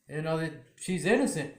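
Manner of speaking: ordinary talk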